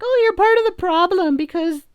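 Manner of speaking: squeaky falsetto imitation of a scold; mocking critic. Critics scolding someone bored by the election, mocking falsetto. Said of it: "And it's like".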